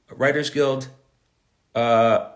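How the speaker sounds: usual speaking voice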